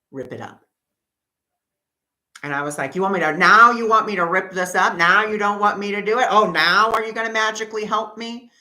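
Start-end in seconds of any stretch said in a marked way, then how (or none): 0.1-0.6 very soft, low, barely breathed; a still small voice, gently; soft inner voice. Retold or imitated. The inner voice within her, very soft and low. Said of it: "just whisper ever so slightly". 2.9-8.5 loud, high sarcastic shouting; sarcastic yelling. Retold or imitated. Yelling sarcastically at Spirit for showing up late, loud and high. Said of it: "still screaming, yelling at Spirit".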